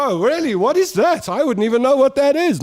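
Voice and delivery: high, mock-innocent voice playing dumb; innocent high denial. Pretending innocence to the salon staff, high mock-innocent voice. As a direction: squeak out a fake innocent denial.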